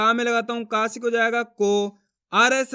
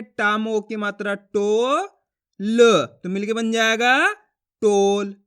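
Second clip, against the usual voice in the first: slow teacher's spelling-out, टो drawn long and rising; stretched syllables with pauses between; letter-by-letter sounding out; 3 dB louder, slower than usual. Shown as a demonstration. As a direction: sound out टो, then ल, then blend them into टोल. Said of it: "देखो किस तरीके से है".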